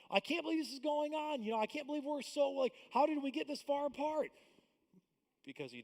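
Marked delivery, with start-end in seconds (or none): 0.1-4.3 high, loud, bewildered panic; lightly mock frantic disbelief; panicked person. Couple shocked by debt, high mock panic. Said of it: "we go".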